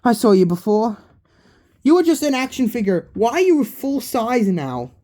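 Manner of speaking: put-on high, loud kid voice for Balloon Boy; excited high character voice. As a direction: pitch it up as the cheeky balloon kid.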